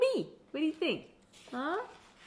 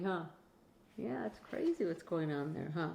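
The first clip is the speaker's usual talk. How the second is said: ordinary delivery, nothing marked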